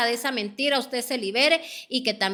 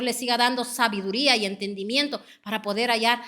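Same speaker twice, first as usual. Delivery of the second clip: ordinary talk, nothing marked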